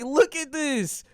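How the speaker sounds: high, stretched-out insistence; insistent urging. Retold or imitated. Urging his sisters to look, high and insistent. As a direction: draw it out, eager to show them.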